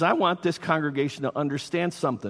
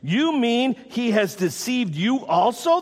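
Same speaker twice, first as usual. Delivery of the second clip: raised, drawn-out incredulous question; acted disbelief, pitched up; sharp retort; 6 semitones higher, 3 dB louder than usual. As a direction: retort as an affronted religious leader. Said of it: "the Pharisees retorted".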